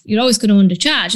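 ordinary talk

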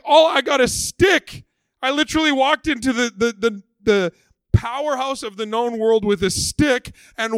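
high, loud exasperated outburst; frustrated Moses. Retold or imitated. Moses shouting back at the grumbling people, exasperated and loud. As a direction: throw up your hands, loud and high pitched, at a stick's worth of thanks. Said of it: "Like, he's frustrated".

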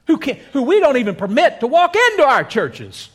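high, loud, indignant crowd; mock outrage. Crowd objects to Jesus visiting a sinner, outraged. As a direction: push it up in scandalized protest. Preacher voices the crowd's scorn.

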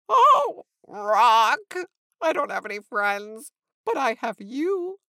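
loud, dramatic, self-pitying wail; hammed-up tearful pleading, rising at the end; sniffling lament. A narrator's reading. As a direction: cry out your woes to your only friend. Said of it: "sniffled Sean".

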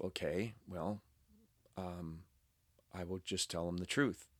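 half-whispered thought before answering a fan; quiet inner thought. Retold or imitated. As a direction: mutter it to yourself while deciding. Thinking to himself before answering.

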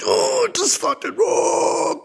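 high strangled shout; mock rage yell. Driver losing it at the fly, high strangled yell. His own rage retold.

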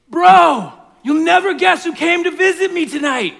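loud, high excited bro shouting; frat bro. Acted. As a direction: yell like a hyped frat bro at midnight. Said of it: "this is how the story goes".

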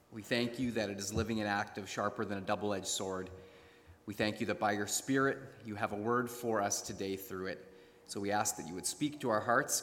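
soft, hushed prayer voice. Real, not acted. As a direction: pray quietly and reverently. Opening prayer.

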